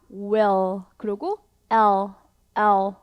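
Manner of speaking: will and L with lips rounded hard for w and tongue held on L; exaggerated mouth shapes. Shown as a demonstration. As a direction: overdo the mouth so learners see it. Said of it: "오버해서 발음을 하고 있어요". (입 모양을 과장한 will과 L 발음)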